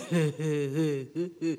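low, slow chuckle; bear laughing along. A narrator's reading. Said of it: "Brer Bear was laughing right along".

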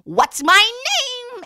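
high, loud, slow impish little man; the little man demands his name, high and loud. Acted. Little man voiced in story.

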